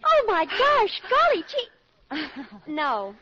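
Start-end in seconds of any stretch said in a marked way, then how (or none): 0.0-1.7 high, louder gasp of disbelief; astonished. Acted. Daughter stunned by her mother's scheme, high astonished gasp. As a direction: let the shock burst out high.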